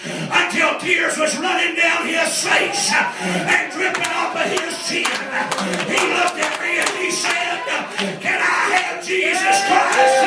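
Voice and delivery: high, shouted revival preaching; impassioned, strained testimony at a shout; full-force preaching. Real, not acted.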